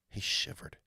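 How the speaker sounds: breathy whisper of a shiver. A narrator's reading. Narrator whispering that Edmund shivered. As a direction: whisper it as if cold.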